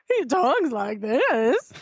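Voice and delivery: mocking squeaky voice-crack, drawn out; slow, high, cracking teen impression; breaking adolescent voice. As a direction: imitate a fourteen-year-old whose voice keeps cracking. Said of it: "breaking like he's fourteen".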